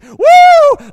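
wild celebratory whoop, laughing; laughing woo of someone blowing money; party whoop. Retold or imitated. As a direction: let out a big party woo.

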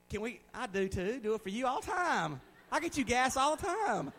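high, climbing, playful protest; mock indignant protest. Preacher defends his gas runs to a doubter, mock indignant.

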